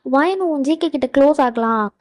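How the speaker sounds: usual speaking voice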